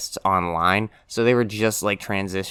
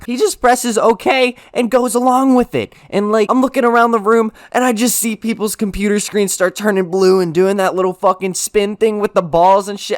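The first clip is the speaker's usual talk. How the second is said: much higher and louder, wound up; excited retelling; 10 semitones higher, 6 dB louder than usual. Retold or imitated. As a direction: tell it like you can't believe it happened. Reliving classmates' screens crashing.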